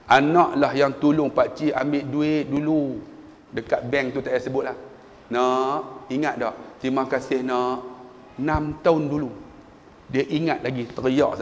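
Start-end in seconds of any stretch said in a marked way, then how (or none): 0.1-9.3 soft, tender thanks; quiet, heartfelt elder; grateful old man. Retold or imitated. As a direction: say it gently, remembering a kindness. Old man thanking his past helper.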